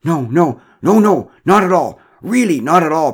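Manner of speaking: loud, high, repeated denials; emphatic protest. A narrator's reading. Derek denies smoking to Mara, loud flustered protest. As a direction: deny it too hard and too loudly. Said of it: "protested Derek".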